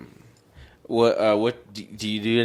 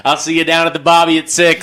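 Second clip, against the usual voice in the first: loud Aussie send-up, a bit higher; mock Australian accent; 4 semitones higher, 7 dB louder than usual. Riffing on the Australian friend, mock Aussie accent. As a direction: call out like a mock Aussie mate. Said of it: "From the down under".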